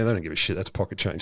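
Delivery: dropped low and soft, deadpan; dry aside. Real, not acted. To co-host about the cost, low dry deadpan aside. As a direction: mutter it low like a wry aside.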